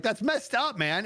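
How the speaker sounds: high-pitched retold objection; mock-indignant, high voice; indignant protest. Retold argument with friend.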